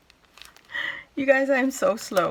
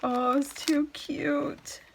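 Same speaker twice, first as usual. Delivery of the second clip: ordinary talk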